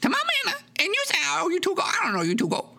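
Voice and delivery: put-on high, fast odd old man voice; squeaky rambling manor owner; Horace voice.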